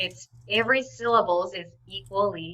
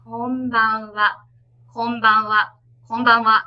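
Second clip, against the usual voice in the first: "konbanwa" repeated, each time faster and louder; speeding up; 7 dB louder than usual. Shown as a demonstration. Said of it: "faster and faster".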